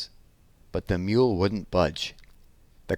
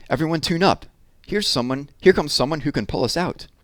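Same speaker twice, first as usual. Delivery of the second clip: higher, eager conductor rallying the band; hopeful conductor; 7 semitones higher than usual. Acted. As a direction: spot rescue and call it out hopefully.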